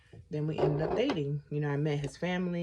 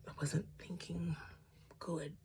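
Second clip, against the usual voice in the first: soft rueful whisper; whispered admission; 9 dB quieter than usual. Real, not acted. Admitting to viewers she wasn't thinking clearly, whispered. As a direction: confess it under your breath.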